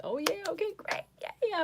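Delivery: high, bright burst of yeahs; excited agreement. Retold or imitated. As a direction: gush along, way up in pitch. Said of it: "Then I'm like".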